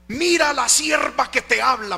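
loud, high commanding proclamation; imitated shouted prophecy. Preacher imitates the woman presenting herself as God's servant, loud and high. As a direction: call it out like an insistent prophetess. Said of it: "y a los gritos".

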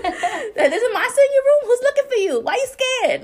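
high, squeaky mock mom voice; mock worried mom. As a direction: mom grilling her child in a mock falsetto. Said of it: "she didn't ask me that".

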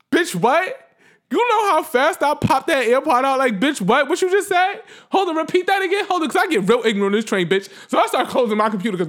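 loud, high re-enacted outburst; really loud, hyped. Retelling herself squaring up to the man on the train, loud and hyped. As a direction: act out being ready to fight. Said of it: "I know I was really loud".

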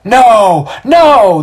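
very high, loud cries; outraged mob shouting refusal; worked-up crowd. A narrator's reading. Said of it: "The crowd gets worked up again".

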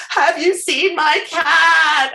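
high, drawn-out wail; hammed-up tearful plea, stretched slow; mock bawling. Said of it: "I'm, like, bawling and calling".

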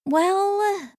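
high, drawn-out hesitant word; reluctant concession. A narrator's reading. Weasel sister with family listening, reluctant. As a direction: stall on it in a high voice.

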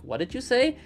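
a light, high "what did you say?"; confused local. Retold or imitated. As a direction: imitate a baffled listener. People in China not understanding her.

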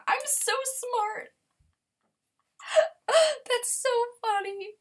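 high, slow, mock-proud sarcasm; sarcastic self-mockery. Real, not acted. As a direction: laugh at her own mistake in a mock-proud tone. Mocks herself after forgetting.

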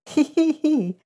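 very high, tinny hee hee hee; giggling. Acted. The little trouts giggling at wet Reddy Fox, very high hee hee. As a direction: giggle like tiny fish. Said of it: "laughed all the little trouts".

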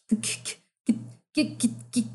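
"get" broken into repeated stuck starts; halting, choked repeats of "get" with gaps; impersonated stutter. Retold or imitated. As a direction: stammer on "get", stuck on the first sound. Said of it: "I've been impersonating his stutter".

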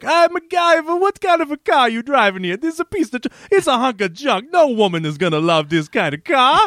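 hammed-up, high-pitched Jamaican accent; loud, exaggerated Jamaican accent that starts very high and comes down. Co-host mimicking Chauncey mocking MacGyver's car, hammed-up Jamaican accent. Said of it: "that's my best Jamaican accent".

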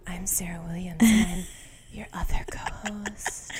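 soft whispered intro; ASMR whisper. Acted. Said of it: "This episode's gonna be A S M R".

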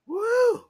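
high, loud "woo"; relishing whoop. Real, not acted. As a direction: whoop long and high at the memory. Relishing the memory.

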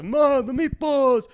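loud, high Italian-neighbor holler; caricatured old Italian shouting for Ma; old Italian guy. Acted. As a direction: drag it out, yelling like an old Italian man. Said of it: "I got the old Italian guy".

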